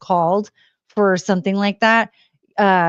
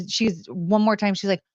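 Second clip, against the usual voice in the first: ordinary talk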